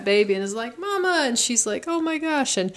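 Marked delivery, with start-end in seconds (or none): 0.8-1.2 put-on high squeaky baby calling mama. The huge baby calling his mother, high baby voice. 1.9-2.6 high, lightly hammed-up gasp of surprise; a mock stunned exclamation; mock shock. Yubaba seeing her baby son again, high mock shock.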